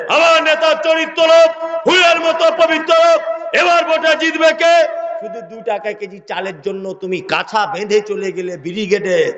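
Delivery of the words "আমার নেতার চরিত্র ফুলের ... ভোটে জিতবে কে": high, loud level chant; political slogan. Acted. Party supporter praising his leader on the march, shouted slogan. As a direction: shout it in measured bursts like a rally leader. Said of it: "slogan দিতে দিতে".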